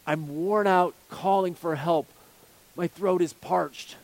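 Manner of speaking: drawn-out, heavy reading of a lament; slow lament. Acted. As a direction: let each word of the cry sink slowly. Reading David's lament slowly.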